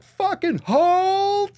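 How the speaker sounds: high slow strained yell; strained battle cry. Retold or imitated. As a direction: hold the line, desperately. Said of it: "My brain's like".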